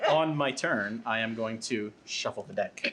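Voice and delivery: slow, drawn-out game announcement. Acted. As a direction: stretch every word like a big reveal. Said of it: "What's muffling your voice?".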